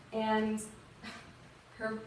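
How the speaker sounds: ordinary delivery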